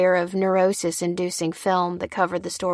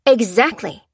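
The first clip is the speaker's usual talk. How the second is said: loud, raised, impatient retort; irritated, higher-pitched rebuke; snappish retort; 5 semitones higher, 6 dB louder than usual. Acted. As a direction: challenge them to disagree. Seller snaps at her assistant.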